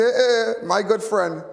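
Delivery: high, loud hearty hello to a friend; imitating a boisterous old friend greeting you. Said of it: "he say".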